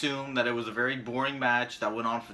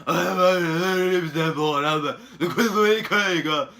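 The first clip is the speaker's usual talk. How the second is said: slow, higher and louder put-on wrestler voice with a laugh breaking in; hammed-up Neville voice; 4 semitones higher, 8 dB louder, slower than usual. Reviewer mocking Neville's cruiserweight king promo, hammed-up slow voice. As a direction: ham up the champ's boast slowly. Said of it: "let me do the voice".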